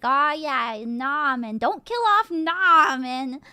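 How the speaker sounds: mocking fans. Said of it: "Everyone was like".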